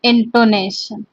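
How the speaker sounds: each word spaced out for notes; teacher dictating notes; slow dictation. Shown as a demonstration. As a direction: dictate it slowly so students can write. Teacher dictates the list slowly.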